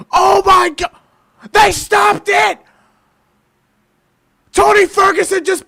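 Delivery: screaming high and loud in disbelief; stunned shouting fan; shocked yelling. Real, not acted. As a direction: yell at the screen as the fight ends. Replayed clip of reaction.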